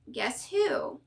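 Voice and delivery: ordinary delivery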